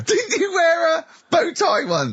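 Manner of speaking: slow, high, through laughter; laughing question. Real, not acted. Host teases about the bow tie, laughing. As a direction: tease him while laughing.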